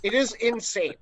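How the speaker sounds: loud, high amazement; excited. Real, not acted. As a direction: rave about it. Praises friend's drinking.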